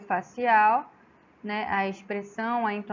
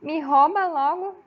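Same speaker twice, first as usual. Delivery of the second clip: ME ROUBA LOGO loud, on a raised, emphatic tune; entonação expressiva; 6 semitones higher, 7 dB louder than usual. Shown as a demonstration. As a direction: throw out ME ROUBA LOGO high and forceful. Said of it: "você utiliza a entonação de voz". (ME ROUBA LOGO em tom alto e enfático)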